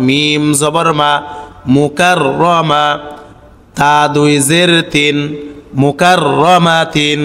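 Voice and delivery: slow, measured spelling chant; correct pronunciation drill. Shown as a demonstration. Teacher shows learners the correct reading, chanted evenly. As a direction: recite syllable by syllable, holding long sounds. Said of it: "সঠিক উচ্চারণটা কি হবে লক্ষ্য করুন".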